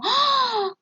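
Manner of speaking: a soft, very high startled "Ah"; surprised ah. Shown as a demonstration. Teacher demonstrates an interjection to students, startled ah. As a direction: let out a small gasp of surprise. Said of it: "esos sonidos que hacemos para expresar una emoción".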